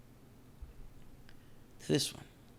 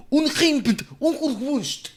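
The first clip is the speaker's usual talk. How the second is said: mock German sign voice; 12 semitones higher, 6 dB louder, much slower than usual. Reading German shop sign mockingly.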